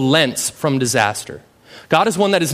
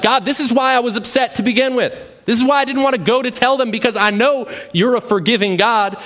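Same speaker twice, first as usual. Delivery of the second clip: Jonah's complaint pitched higher, faster through the middle; a higher-pitched grumble at God; whiny Jonah; 7 semitones higher than usual. Retold or imitated. Preacher voicing Jonah griping at God, high whiny complaint. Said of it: "And he's like".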